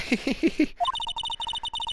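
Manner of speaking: squealing high hysterical laugh; over-the-top high cackle. Acted. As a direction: break into a shrieking giggle.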